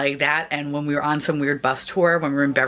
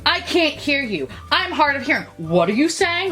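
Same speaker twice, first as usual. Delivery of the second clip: imitated aggressive mom; 11 semitones higher than usual. Hard-of-hearing mom to mumbling passenger, mock aggressive. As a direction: imitate a mother confronting someone, pitched up. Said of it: "she got really aggressive".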